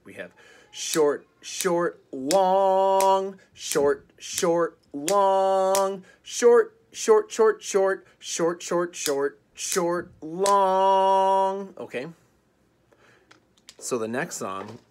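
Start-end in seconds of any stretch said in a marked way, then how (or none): 0.0-11.7 rhythm demo with short clipped words and long, louder held words; long and short beats. Shown as a demonstration. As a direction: sing "short" short and stretch out "long". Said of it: "Long and short is a really important part of music".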